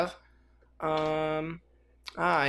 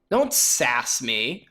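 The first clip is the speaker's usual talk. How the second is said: mock stern; 5 semitones lower, much slower than usual. Scolding a sheep.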